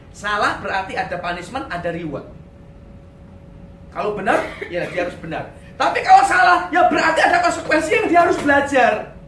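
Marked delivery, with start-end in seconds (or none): 5.8-9.1 emphatic preaching. Real, not acted.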